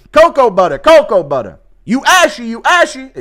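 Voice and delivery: high, loud hyped cornerman shout; mock cornerman yell. Cornerman shouting instructions to his fighter.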